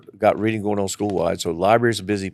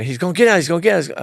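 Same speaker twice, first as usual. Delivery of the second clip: worried warning; 9 semitones higher, 6 dB louder, faster than usual. Retold or imitated. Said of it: "the teacher said".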